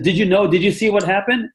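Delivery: high, eager retold question; excited caller. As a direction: imitate someone bursting with news. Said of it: "he was so excited".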